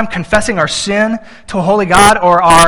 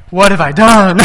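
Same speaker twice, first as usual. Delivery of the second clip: mock dismay; 5 dB louder than usual. Dad's mock dismay at Happy Meal.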